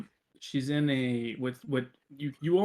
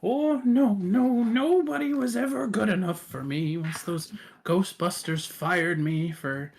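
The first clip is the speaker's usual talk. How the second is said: Tiffany; 6 semitones higher, 4 dB louder than usual. Acted. Aged Tiffany grumbling among her cats, high self-pitying voice. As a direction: lament the old grievance, starting high and sinking. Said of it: "you just hear her basically kind of mutter".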